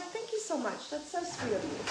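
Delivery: sugary thank-you to a stranger; sweet thanks. Retold or imitated. Thanking lady who takes cart.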